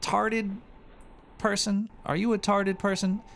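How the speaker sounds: high, drawn-out mocking voice; high mocking taunt, stretched words; mock dumb voice. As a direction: sneer it like talking to an idiot. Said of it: "I'm like".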